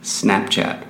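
Snapchat in American accent. Shown as a demonstration. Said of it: "Eu vou falar com o sotaque americano".

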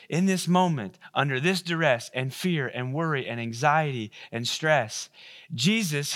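ordinary talk, nothing marked

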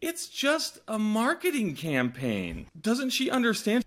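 can't believe the fuss over a campaign; incredulous. Retold or imitated. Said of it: "And I'm like".

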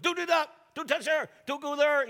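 hammed-up warning to a small child; fussing parent calling out don'ts; parent scolding a toddler.

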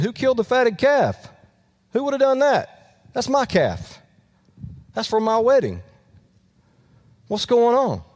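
voce alta e incredula, indignata; outraged disbelief. Retold or imitated. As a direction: urla stupito davanti alla festa. Older brother outraged at the party.

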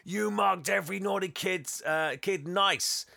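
high, loud furious telling-off; angry Santa. Retold or imitated. Santa furious at Fred over the stamped files. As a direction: rage at him. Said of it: "He's really angry".

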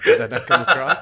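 loud, high laughing question. Real, not acted. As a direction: ask it while cracking up. Laughs at his failed echo.

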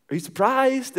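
high-pitched, expectant retold question; eager question. Surprise visitor asking the birthday woman, eagerly. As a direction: ask it high, fishing for a big reaction.

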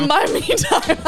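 laughing through the repeat of In my meantime. Real, not acted. Friend repeating her own slip, laughing. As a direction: giggle out the flubbed phrase.

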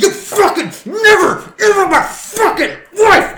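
high, slow, full-throated roar of rage; furious roar. Acted. Husband bellowing over his wife while beating him. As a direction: scream it at the man you're smashing. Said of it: "He's like".

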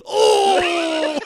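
loud, high "oh" of pain; imitated pained cry. Imitating Ty hit while filming, loud pained cry. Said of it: "You can hear Ty King go".

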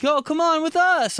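put-on high kid's call to join in. Neighbourhood kids inviting him along, high calling out.

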